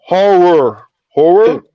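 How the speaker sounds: "horror" drawn out on a falling first syllable; deliberately off pronunciation. Pretend. Teased by a friend over saying horror, deliberately mispronounced. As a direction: say "horror" stretched and exaggerated, wrong on purpose. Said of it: "I'm doing it on purpose now".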